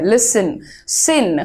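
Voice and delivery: listen said low, then its ending alone as "sn"; listen as "LIS-sn", then just "sn"; sounding out listen. Shown as a demonstration. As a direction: break out the reduced last syllable of listen. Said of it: "listen है".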